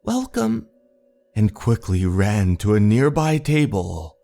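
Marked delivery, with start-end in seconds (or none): 0.0-0.7 high-pitched staff welcome; restaurant greeter. Acted. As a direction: chirp a greeting to a customer, pitched well up. Restaurant staff greet arriving customer.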